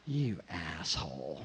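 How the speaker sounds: disappointed. Retold or imitated. Bus seatmate let down by the narrator, low disappointed grumble. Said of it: "like, disappointment".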